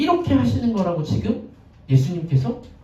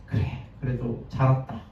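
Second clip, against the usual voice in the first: a hint of a father holding back tears, softer; mild tearful murmur over a sleeping son; 6 semitones lower, 8 dB quieter than usual. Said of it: "막 울면서". (잠든 아들 곁에서 울먹이듯 조용히)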